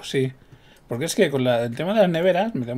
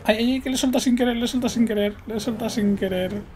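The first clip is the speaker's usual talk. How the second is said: flustered panic; 9 semitones higher, faster than usual. Real, not acted. Lets go of vehicle by accident.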